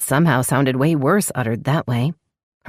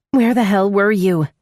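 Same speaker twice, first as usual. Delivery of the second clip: high, loud shrill demand; 6 semitones higher, 4 dB louder than usual. A narrator's reading. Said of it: "Her voice sounded shrill".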